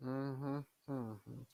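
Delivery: usual speaking voice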